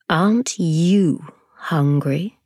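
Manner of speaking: slow, odd-timbred spider; sly spider question. Acted. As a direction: tempt him, slowly and slyly. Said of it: "she asked suddenly".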